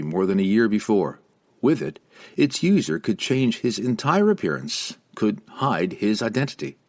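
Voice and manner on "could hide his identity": ordinary delivery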